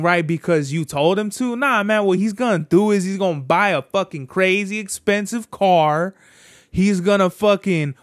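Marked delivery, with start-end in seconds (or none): none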